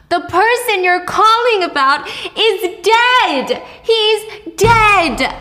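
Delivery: high, drawn-out furious cry; losing it. Acted. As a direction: raise the pitch and hammer each word slowly. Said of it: "She's screaming".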